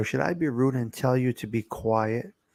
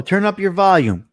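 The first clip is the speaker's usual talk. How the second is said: a touch louder, asking to be heard; speaking up; 7 semitones higher, 8 dB louder than usual. Shown as a demonstration. Host asks a listener to turn up, speaking up. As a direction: raise the voice so the listener can hear. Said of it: "Speak up too low".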